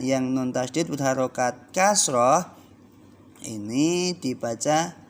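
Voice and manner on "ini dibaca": usual speaking voice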